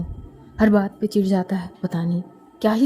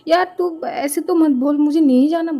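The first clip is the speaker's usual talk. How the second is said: whiny refusal; 6 semitones higher than usual. Acted. Friend refusing her pleading bestie, higher and whinier. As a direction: dig in with a higher whine.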